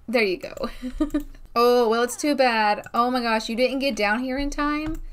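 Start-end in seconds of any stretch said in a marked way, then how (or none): none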